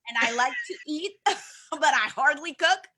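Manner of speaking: giggling through the confession; laughing. Real, not acted. Guest laughs at herself telling the host she hardly cooks. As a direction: laugh it out, self-mocking.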